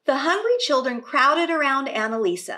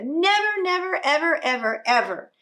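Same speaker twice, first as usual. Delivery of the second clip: stubborn refusal; 4 semitones higher, 4 dB louder than usual. Acted.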